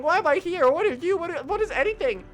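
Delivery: high, loud bewildered ranting; exasperated outburst. Acted. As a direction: vent total confusion at the boss.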